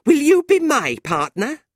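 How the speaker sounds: very high, loud and pointed; angry invitation. A narrator's reading. Fanny asking John to spite Tilda, angry. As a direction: fling the question out in pique. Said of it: "looking at Tilda angrily".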